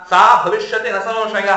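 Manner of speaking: slow, measured verse recital; shloka recitation. Acted. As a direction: recite each syllable deliberately. Sanskrit verse recited slowly. (ನಿಧಾನವಾದ ಶ್ಲೋಕ ಪಠಣ)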